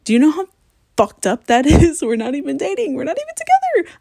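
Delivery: high, loud, half-laughing outburst; exasperated disbelief. Real, not acted. Vlogger on crying over someone she isn't dating, exasperated squeal.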